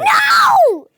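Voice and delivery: loud high shouted no. Real, not acted. Georgie protesting being dead, shout. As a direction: yell in protest.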